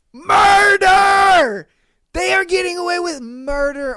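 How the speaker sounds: high, loud shout of outrage; outraged shout. Real, not acted. Vlogger ranting about cheating women, shouting. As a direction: bellow the one word.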